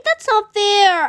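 ordinary delivery

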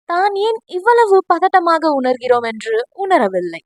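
usual speaking voice

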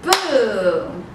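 long, falling firework boom; booming sound word for kids; demonstrated boom. Shown as a demonstration. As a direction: draw out the boom like a firework going off. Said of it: "every time we say the word boom".

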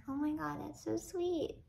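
swooning aww at a milk bottle; gushing. Retold or imitated. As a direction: melt over how sweet it is.

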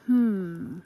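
usual speaking voice

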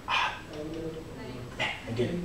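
quiet, deflated sigh of regret; acted hushed defeat; dejected self-reproach. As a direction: let it sink out softly, disappointed in yourself. Acts out dejection after failing again.

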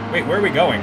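ordinary talk, nothing marked

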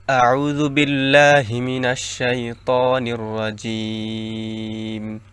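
slow chanted Quranic recitation. Acted. Teacher opening the Quran lesson, slow recitation. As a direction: intone it slowly and devoutly.

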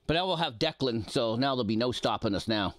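ordinary delivery

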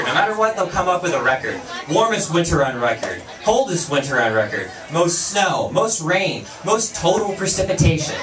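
normal talking voice

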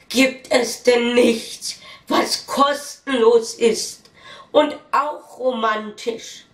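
hammed-up pleading inner voice; exasperated plea. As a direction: stretch every word in mock despair. Man's exasperated thought over dinner.

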